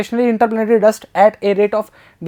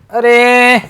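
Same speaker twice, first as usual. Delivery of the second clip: startled; 3 semitones higher, 8 dB louder, much slower than usual. Real, not acted. Startled by the question.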